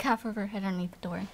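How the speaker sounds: normal talking voice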